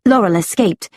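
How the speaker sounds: usual speaking voice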